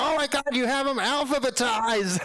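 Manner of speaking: high, squealing delight; thrilled book lover; gushing amazement. Retold or imitated. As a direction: gush over the shelves. Delivery guy delighted, alphabetized books.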